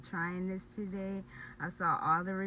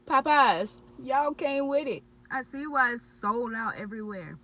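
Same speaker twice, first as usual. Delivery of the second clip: loud, high enthusiastic praise; excited; 5 semitones higher, 9 dB louder than usual. Real, not acted. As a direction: hype it up. Praising the sandwich.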